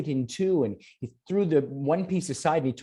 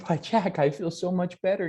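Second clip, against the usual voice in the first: higher, bright with relief; a healed mother telling her son she feels better, in a higher voice; revived mother; 6 semitones higher than usual. Acted. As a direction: sound suddenly alive and well again.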